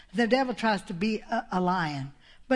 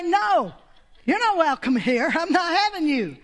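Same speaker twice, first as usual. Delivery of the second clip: loud, raised-pitch refusal; forceful rebuke; 7 semitones higher, 5 dB louder than usual. Acted. Preacher showing how to refuse sickness, forceful and loud. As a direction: turn sickness away emphatically.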